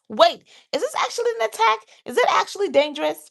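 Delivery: high, loud, fast questions; acted alarmed questioning. Brain checking whether it is an attack, high rapid alarm.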